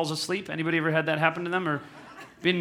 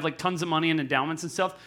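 ordinary talk

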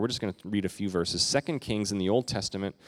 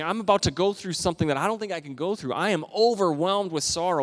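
higher, anguished voice of Jesus; Jesus overwhelmed; 8 semitones higher, 3 dB louder than usual. Retold or imitated. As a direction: speak as Jesus in the garden. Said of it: "This is Jesus saying".

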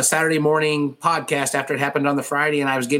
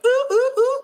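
high falsetto ooh ooh ooh; giddy high-pitched fan squeal; excited squeal. Retold or imitated.

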